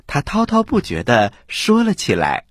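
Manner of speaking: ordinary delivery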